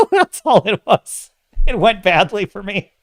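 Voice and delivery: high, loud talk through laughter; real laughing. Real, not acted. Streamer mocking a movie's feeble demon attack, laughing. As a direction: laugh helplessly while getting the words out.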